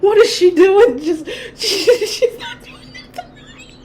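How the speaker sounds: high, cracking, laughing voice; laughing impression. Lucifer watching her fail, voiced through helpless laughter. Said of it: "he's just like".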